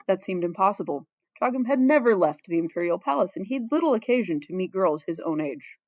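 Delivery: ordinary delivery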